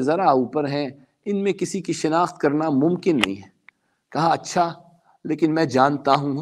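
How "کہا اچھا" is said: normal talking voice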